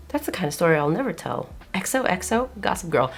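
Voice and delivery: soft, knowing gossip-narrator sign-off; a light Gossip Girl narrator voice. Retold or imitated. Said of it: "I said that in a very Gossip Girl tone".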